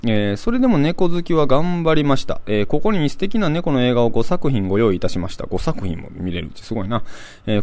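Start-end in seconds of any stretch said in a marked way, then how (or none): 0.4-5.5 higher-pitched, swooping event pitch; promotional flyer read. Acted. Radio host reading cat film flyer to listeners, promotional read. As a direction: read the blurb like an upbeat promo.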